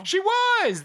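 high, bursting "was" of agreement; excited agreement. Real, not acted. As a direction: jump in, thrilled she agrees. Excitedly agreeing about the heroine.

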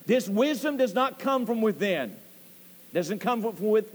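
ordinary talk

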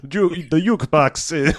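hammed-up Eastern European accent, laughing; fake Eastern European accent. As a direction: fumble it in a weird, vaguely Armenian accent. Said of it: "like, Armenian, like, Eastern European thing".

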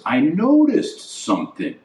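ordinary talk, nothing marked

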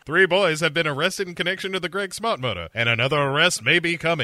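high, fast mock newscaster; put-on urgent newsreader voice; TV news anchor. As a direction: rattle off a bulletin like a hammy anchor. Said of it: "on the news, it's like".

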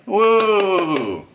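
high, loud, drawn-out whoop; long falling cheer. A narrator's reading. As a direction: whoop like a delighted crowd.

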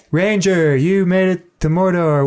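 put-on light Indian accent, a bit slow; a hint of an Indian-accented orc greeting. Comedian's orc greeting the Ranger, lightly accented. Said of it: "one of these sort of voices".

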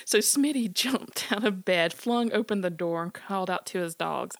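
plain reading voice

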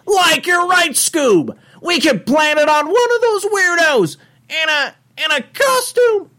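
very high, loud cartoon slacker voice; hammed-up high cartoon sidekick; Shaggy. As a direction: hatch a plan in an excited cartoon squeal. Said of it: "I have to hear the Shaggy".

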